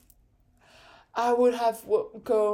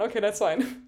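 normal talking voice

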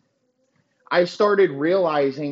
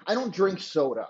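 usual speaking voice

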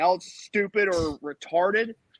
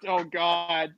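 ordinary delivery

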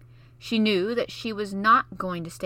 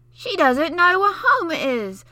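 put-on much higher, louder, slow scornful child; childish scorn; 10 semitones higher, 10 dB louder, slower than usual. Basil mocking Mary's ignorance, childish scorn. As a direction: sneer like a seven-year-old. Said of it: "with seven-year-old scorn".

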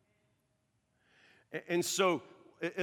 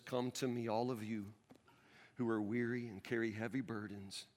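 tender scripture; 4 semitones lower, 6 dB quieter than usual. Acted. Quoting Jesus, closing.

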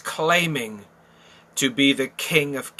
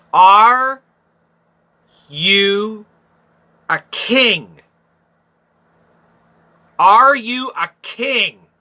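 loud mock Pilate; 5 semitones higher, 8 dB louder, much slower than usual. Preacher acting out Pilate's question.

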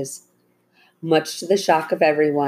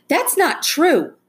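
high, loud, drawn-out protest; indignant outburst; 12 semitones higher, 5 dB louder, slower than usual. Acted. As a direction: cut in as an indignant girl, pitched way up. Girl interrupts the lying driver.

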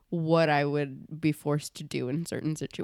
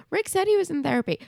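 high, fast mock objection; quick, squeaky know-it-all correction; nitpicking tweeter; 12 semitones higher, 4 dB louder, faster than usual. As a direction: imitate an online nitpicker in a raised pitch. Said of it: "before people tweet at me being like".

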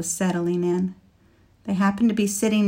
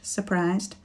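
ordinary delivery

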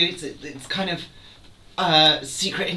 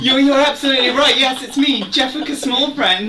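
louder, higher and fast, proudly announcing; grand reveal; 7 semitones higher, 6 dB louder than usual. Acted. Jeffica reveals royal identity to the guard, grand and excited. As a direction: throw it out like a big dramatic unmasking.

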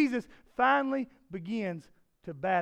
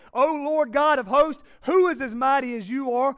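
louder, higher declaiming of the psalm; proclaiming scripture; 6 semitones higher, 8 dB louder than usual. Shown as a demonstration. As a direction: proclaim it to the congregation. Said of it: "verse nine says this".

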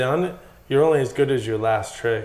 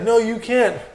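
mock scolding; 10 semitones higher, 4 dB louder, slower than usual. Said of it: "He'd be like".

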